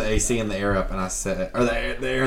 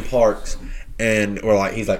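ordinary talk, nothing marked

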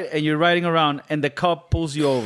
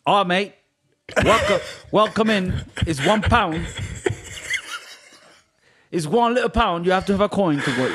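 higher-pitched comic English gatekeeper; English accent; 5 semitones higher than usual. Acted. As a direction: welcome them like a cartoon English agent. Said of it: "the T S A agent say".

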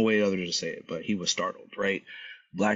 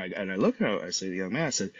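ordinary talk

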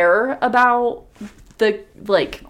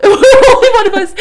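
high, loud, cracking up; laughing; 12 semitones higher, 11 dB louder than usual. Real, not acted. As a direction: burst out through real laughter. Cracking up at failed whistling.

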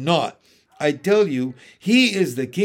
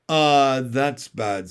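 lightly voiced Mister Beaver; 5 dB louder, slower than usual. Beaver voiced in read story.

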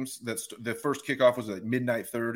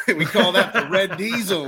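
laughing through the words, pitched up; 6 semitones higher, 5 dB louder than usual. Real, not acted. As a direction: chuckle your way through it. Laughing while repeating the quote.

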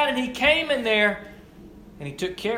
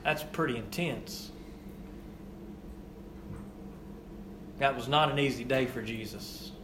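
hushed and solemn; 10 semitones lower, 10 dB quieter than usual. Real, not acted. Hushed after climax.

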